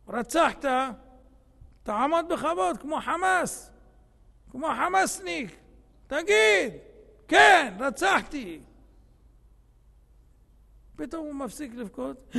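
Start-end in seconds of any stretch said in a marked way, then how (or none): 0.1-8.6 friends' taunting shout. Acted. Friends in the courtroom taunt him.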